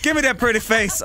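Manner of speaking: loud, relishing mock menace; gloating shout. Killer player to victim, gloating shout. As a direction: crow loudly over your caught victim.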